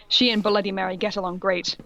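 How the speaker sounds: ordinary delivery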